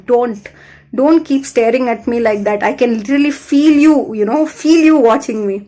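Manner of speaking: exasperated protest. Retold or imitated. Pleading complaint to her staring father.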